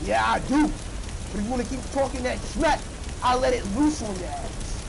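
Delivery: loud threatening yell; angry yelling. Pretend. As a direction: shout the threat at him. Threatening NPC with fire.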